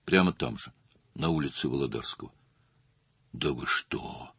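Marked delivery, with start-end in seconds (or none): 3.3-4.3 slow, low, hushed gasp of shock; gasped surprise. A narrator's reading. Stas gasps at news of the murder, slow and hushed. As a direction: breathe it out stunned. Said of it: "ахнул Стас".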